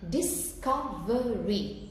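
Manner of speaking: "Discovery" slowly split into four beats, dis-co-ve-ry; syllable by syllable. Shown as a demonstration. Teacher counting syllables for students, "Discovery" in four slow beats. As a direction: sound each of the four vowels in "Discovery", slowly. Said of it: "So you've got four syllables".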